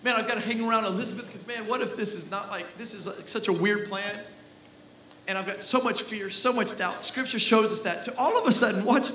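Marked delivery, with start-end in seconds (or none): 0.0-7.0 fearful doubt. Retold or imitated. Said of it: "like, fear and doubt".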